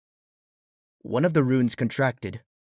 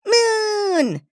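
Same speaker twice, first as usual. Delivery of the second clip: very high, held shout; shouting little creature; 5 dB louder than usual. A narrator's reading. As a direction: cry it like a tiny joyful ball. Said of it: "cried another".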